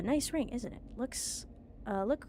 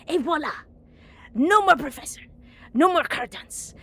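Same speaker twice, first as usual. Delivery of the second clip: triumphant loud exclaim; gleeful shout; 6 semitones higher, 14 dB louder than usual. Acted. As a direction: crow it out in triumph. Gloating villain reveals scheme.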